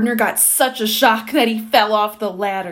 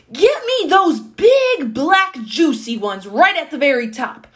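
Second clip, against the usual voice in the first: put-on loud, emphatic old man's demand; shouting; 5 semitones higher, 7 dB louder than usual. A narrator's reading. Old man ordering gardener up the ladder, shouting. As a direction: shout it up the tree, punching each word. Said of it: "the old man was shouting".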